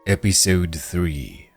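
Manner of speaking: ordinary delivery, nothing marked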